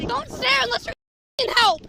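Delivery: loud, bitter shout; angry yell. Real, not acted. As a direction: snap at the onlookers. Woman yelling at onlookers.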